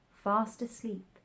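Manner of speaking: ordinary delivery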